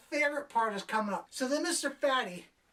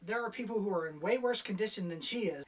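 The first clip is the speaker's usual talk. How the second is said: ordinary delivery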